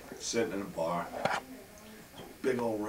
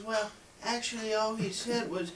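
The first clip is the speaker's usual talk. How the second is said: high, hushed character voice; soft, high voice of a messenger; reluctant go-between; 7 semitones higher, 10 dB quieter than usual. Acted. Joke teller voicing the friend relaying the Pope's words, high and soft.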